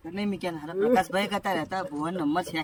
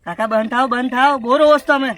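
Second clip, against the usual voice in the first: loud, raised-pitch demand to stop crying; exasperated shouting; 3 semitones higher, 10 dB louder than usual. Acted. Fed-up carer telling crying old uncle to stop, exasperated shout.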